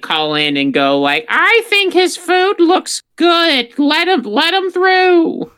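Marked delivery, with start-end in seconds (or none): 1.3-5.5 high-pitched mock viewer. Mock viewer calling in to vote.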